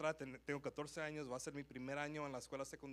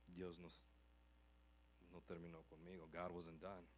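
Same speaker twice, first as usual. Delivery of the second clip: soft sermon; 8 semitones lower, 11 dB quieter than usual. Acted. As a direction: gently preach to the congregation. Preaching softly. (predicación suave y baja)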